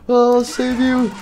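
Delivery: high, loud, slow rescue cry; drawn out heroic call; heroic Foxy. Acted. Foxy the pirate rushing to save the others. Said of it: "Let me do it".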